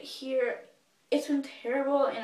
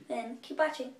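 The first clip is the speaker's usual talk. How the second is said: ordinary talk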